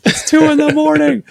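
high, frantic pleading voice; desperate new parent. Retold or imitated. Tired parent at two in the morning, high and desperate. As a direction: beg for the crying to stop. Exhausted parent at 2am crying baby.

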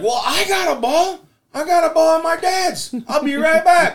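put-on kid voice. Scotty voiced in retold scene.

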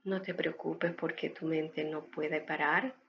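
calm breathing guide, unhurried and gentle; guided meditation. Acted. (voz de meditación guiada)

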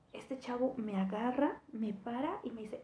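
ordinary delivery

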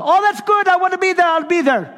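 high, loud, bubbling eager voice; excitable Brother Vic. Retold or imitated. Vic insisting to the pastor that he's coming. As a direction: burst in like a thrilled guest preacher. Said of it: "He was an excitable kind of guy".

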